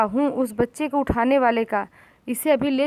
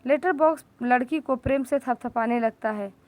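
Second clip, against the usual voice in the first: ordinary delivery, nothing marked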